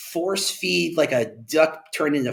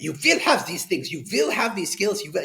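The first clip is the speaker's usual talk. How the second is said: higher mock German accent, commanding; 7 semitones higher than usual. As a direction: bark it like a stern German boss in a mock German accent. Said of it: "you do it in a real German accent".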